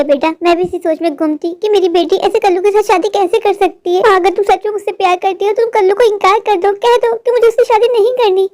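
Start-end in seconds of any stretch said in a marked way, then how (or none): none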